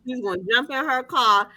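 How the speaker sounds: ordinary talk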